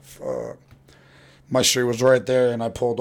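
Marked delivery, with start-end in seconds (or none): 0.0-0.6 a breathless whispered fuck; whispered curse. Retold or imitated. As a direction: whisper it as the cop pulls up. Caught by cops.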